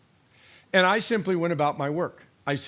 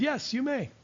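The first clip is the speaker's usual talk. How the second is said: gentle grandpa; 10 semitones higher than usual. Retold or imitated. Grandpa answers again.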